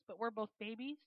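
ordinary talk, nothing marked

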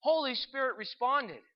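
ordinary delivery, nothing marked

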